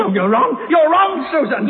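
high, loud furious denial; angry shout. Acted. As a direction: shout her down.

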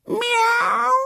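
very high, ringing meow; long echoing miaow; boy's cat meow. A narrator's reading. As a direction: mew like a cat to fool them. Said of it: "uttered a re-echoing meow".

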